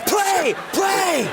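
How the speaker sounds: high mock shout of a freaked-out coach; mock yell. Host imagining Phil Jackson yelling at the meditating team, mock shout. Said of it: "It would have freaked him out".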